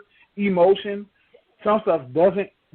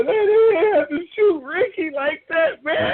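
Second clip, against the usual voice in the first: high, laughing fake sob; hammed-up tearful lament; mock crying; 10 semitones higher than usual. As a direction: wail it like a grieving fan, hamming it up. Mock crying over Ricky.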